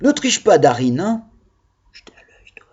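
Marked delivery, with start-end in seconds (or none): 1.9-2.7 whispered mock warning to a pupil; hushed, voiceless teacher's warning; playful whisper. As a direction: whisper it as a teasing aside.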